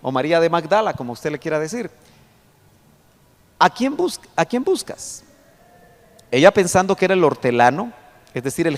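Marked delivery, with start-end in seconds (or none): 3.6-5.2 lightly voiced gentle question, pitched higher; voicing Jesus. Preacher reading Jesus to the weeping Mary, higher voice. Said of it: "Jesús le dijo".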